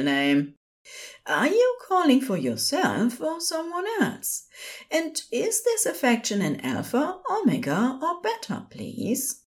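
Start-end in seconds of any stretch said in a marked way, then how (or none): 1.3-9.4 much higher, proper helpline voice; high-pitched, courteous nurse; polite nurse. A narrator's reading. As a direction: reassure the caller politely in a high voice.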